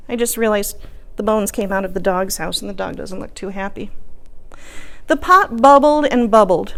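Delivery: ordinary delivery, nothing marked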